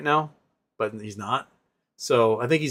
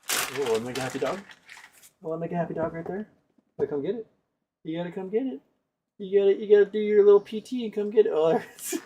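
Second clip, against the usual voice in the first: quick, higher sing-song coaxing; coaxing dog talk; 6 semitones higher, 4 dB quieter, faster than usual. Real, not acted. Owner luring the dog to a pig ear, quick and sing-song. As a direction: tease the dog toward the treat.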